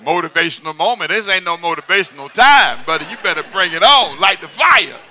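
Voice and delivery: loud fired-up preaching; light the fire, loud; preaching at full force. Acted. Preacher retelling his fired-up funeral sermon, loud.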